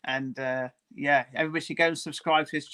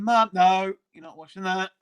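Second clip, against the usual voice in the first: louder, higher "no", then easing off; mock telling-off from a memory; retold protest; 4 semitones higher, 7 dB louder than usual. Speaker retelling a refusal over the film trailers, retold protest. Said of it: "I remember saying".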